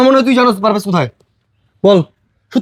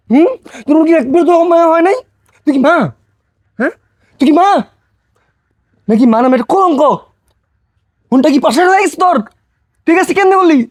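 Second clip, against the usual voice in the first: higher, raised furious reproach; furious scolding; 6 semitones higher than usual. Acted. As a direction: shame her for what she did. Father berates wife for selling son. (ছেলে বিক্রির জন্য স্ত্রীকে রাগে ধমক)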